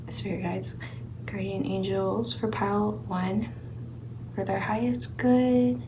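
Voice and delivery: soft, reverent call to the spirit guides; hushed invocation. Real, not acted. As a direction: murmur it like a quiet prayer. Invoking guides for the reading.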